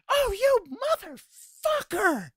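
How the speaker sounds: high, drawn-out imitation of an outraged wife; mock angry yell. Husband imitates wife falling for his prank, high mock yell. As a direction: screech it slowly as the pranked wife. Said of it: "she comes in and yells at me".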